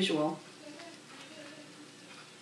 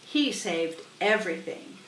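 usual speaking voice

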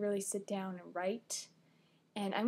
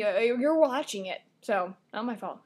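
ordinary delivery

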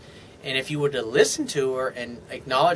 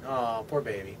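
sarcastic pity; 5 semitones lower, 7 dB quieter than usual. Acted. Mocking the letter writer's complaints.

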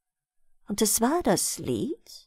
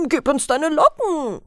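higher-pitched mild call; villagers' eager demand, lightly called; 5 semitones higher, 3 dB louder than usual. A narrator's reading. Villagers demand the girl's curls as payment, calling out mildly. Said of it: "riefen sie da".

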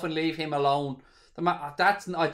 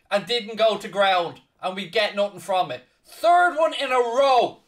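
frustrated rant; 7 semitones higher, 5 dB louder than usual. Real, not acted. Protesting a penalty denial.

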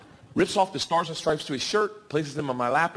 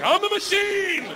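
much higher and slow, stretched out; squeaky proud boast; 3 dB louder, much slower than usual. Retold or imitated. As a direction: squeak it out slowly like a proud claim. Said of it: "I was like".

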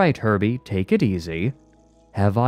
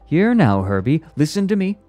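loud, raised, brisk command; angry and impatient; 9 semitones higher, 6 dB louder than usual. A narrator's reading. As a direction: demand the robot's attention. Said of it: "Lanny angry and impatient".

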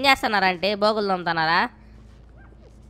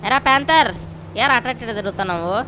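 loud, higher calls at the dog; yelling at the dog Panther; 4 semitones higher, 3 dB louder than usual. Real, not acted. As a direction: shout the dog down.

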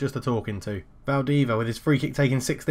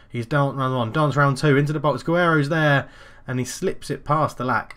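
building louder and higher with the attack; excited play-by-play; 3 semitones higher, 5 dB louder than usual. Real, not acted. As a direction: call the danger as it mounts. Commentary as opponents attack the goal.

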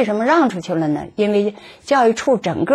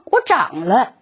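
normal talking voice

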